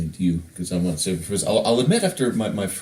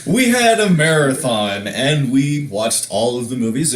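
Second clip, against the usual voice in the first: emphatic restart; 5 semitones higher, 6 dB louder, slower than usual. Acted. Restarting story, third attempt.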